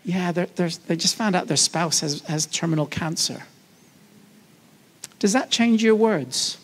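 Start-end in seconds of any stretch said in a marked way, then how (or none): 0.0-3.4 softer, lower confiding tone; a colleague quietly shares bad news; hushed news. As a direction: murmur the sad news softly. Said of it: "you suddenly hear".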